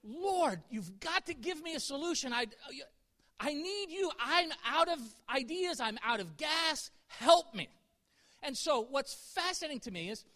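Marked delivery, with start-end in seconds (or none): 0.0-7.7 higher-pitched, urgent plea, re-enacted; retold desperate prayer. Preacher re-enacts praying when out of money, urgent pleading. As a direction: beg for help, building to a louder last cry. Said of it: "I remember praying".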